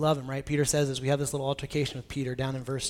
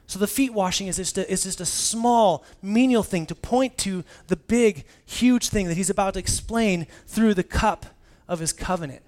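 louder, driving sermon delivery; rising pulpit energy, fast; full-force preaching; 4 semitones higher, 7 dB louder than usual. Acted. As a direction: preach it hard to the room. Preaching climax.

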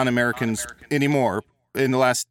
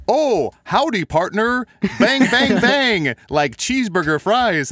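hammed-up cowboy twang, higher and louder; mock American cowboy accent; 8 semitones higher, 5 dB louder, slower than usual. English friends teasing the American student, a hammed-up cowboy twang. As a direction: play the Brits mocking a Yank with a cowboy voice. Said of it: "they would be like".

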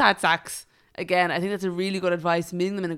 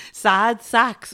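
reads it slowly, laughing; giggling through the words; 4 semitones higher, 9 dB louder than usual. Real, not acted. Corrected reading, laughing.

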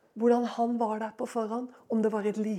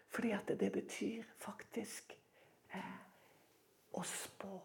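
secretive whisper; 6 semitones lower, 20 dB quieter than usual. Acted. Whispered revelation of meaning.

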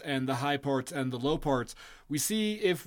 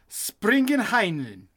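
mock German; 4 semitones higher, slower than usual. Shown as a demonstration. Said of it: "is jump on into it".

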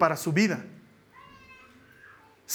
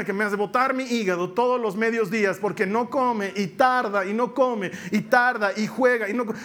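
usual speaking voice

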